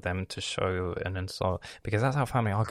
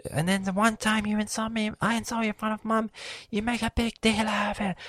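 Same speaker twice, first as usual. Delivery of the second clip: much higher whiny mimic, last line whispered; mocking sister; 14 semitones higher than usual. Imitating his sister's complaint at him, mocking whine. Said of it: "she starts talking with me".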